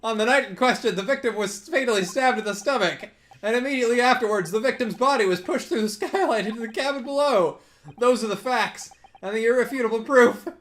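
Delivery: high, insistent advocate; earnest defence lawyer. Acted. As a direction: state the facts loudly to the court. Reads defence lawyer's argument.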